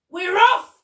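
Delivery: high, loud call to set off; excited cry. A narrator's reading. Monkey sets off to see the Duke, excited cry. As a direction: cry it out at full voice. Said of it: "cried the monkey".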